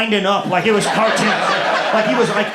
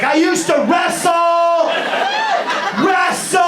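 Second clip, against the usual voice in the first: high, slow frantic yell; crazed yelling; 10 semitones higher, slower than usual. Retold or imitated. Stand-up comic yelling at his street opponent mid-fight. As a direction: scream it like a man losing his mind. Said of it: "I was like".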